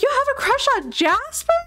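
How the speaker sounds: high, fast incredulous blurt; high-pitched outburst at a friend's secret; loud disbelief. Acted. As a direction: blurt it out far too loudly, voice shooting up. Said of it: "you said that really loud".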